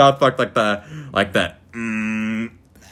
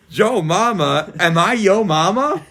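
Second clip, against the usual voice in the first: slow, higher puzzled teacher; confused teacher; 6 semitones higher, slower than usual. Retold or imitated. Teacher repeating the joke, slow and confused. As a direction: drawl it out slowly in disbelief. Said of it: "And then she goes".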